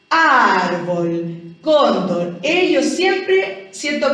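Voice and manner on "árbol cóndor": "árbol", "cóndor" with long, heavy first syllables, Argentine-style; Argentine-style stress. Shown as a demonstration. Teacher exaggerates for pupils, Argentine-style stretched first syllables in "árbol", "cóndor". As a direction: lean hard on "ár" and "cón", Argentine-style. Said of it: "Hablamos como un poquitito argentino".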